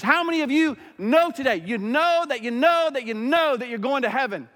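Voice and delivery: high, loud revival-preacher call; rally the congregation like that pastor; Kentucky pastor preaching to his church, fired up. Retold or imitated. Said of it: "He's preaching".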